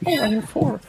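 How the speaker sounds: high, mocking voice. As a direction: sneer it like a spoiled newbie. Mocking lazy new players.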